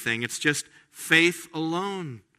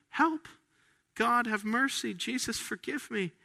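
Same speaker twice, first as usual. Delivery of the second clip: high, pleading cry fading to a hush; a desperate little prayer rising then fading out; desperate plea; 8 semitones higher, 4 dB quieter than usual. Retold or imitated. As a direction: beg for mercy, voice dropping away.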